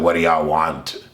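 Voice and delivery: low, grumpy mock retort; irritated Kawaki. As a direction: imitate someone mad at the world, voice dropped low. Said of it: "aggravated and irritated".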